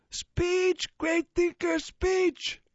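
high, loud crowd shout; yelling. Acted. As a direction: call out for a speech, loud and high. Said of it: "someone yells".

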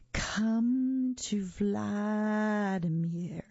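spooky drawn-out summons; icy monster voice. A narrator's reading. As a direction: beckon coldly, stretching every vowel. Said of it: "an icy voice called".

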